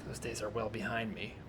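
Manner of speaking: low, hushed distrustful mutter; lightly voiced suspicious loner; paranoid stranger. Jacob recalls his past.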